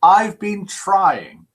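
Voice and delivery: very slow, stretched out pleading; drawn-out plea. Retold or imitated. Reading a viewer's comment, slow drawn-out pleading. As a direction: drag the words out like a long plea. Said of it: "Judas Priest says".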